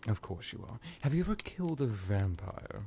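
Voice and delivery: usual speaking voice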